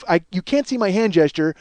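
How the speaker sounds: ordinary talk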